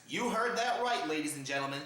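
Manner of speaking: ordinary delivery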